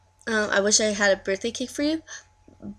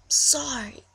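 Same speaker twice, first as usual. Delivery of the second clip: whisper; 3 semitones higher than usual. Real, not acted. Whispered apology after singing.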